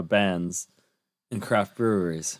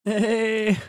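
surfer farmer; 8 semitones higher, slower than usual. Acted. Farmer card, voiced character.

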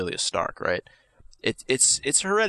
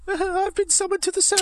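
squeaky, very high excited voice; excited whisper. Acted. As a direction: gush it out in a tiny cartoon squeak. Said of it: "Sam said in an excited whisper".

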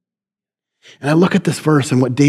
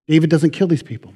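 usual speaking voice

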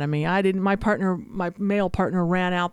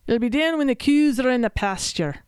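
mock old-country Scottish accent, pitched up; imitated Scottish grandfather; 3 semitones higher than usual. Daughter copying mother imitating her grandfather, Scottish accent. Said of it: "try to imitate her grandfather".

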